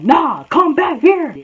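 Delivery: slow, high, loud call; imitated commanding shout. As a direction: mimic someone ordering a person back. Girl calls after departing speaker, imitated.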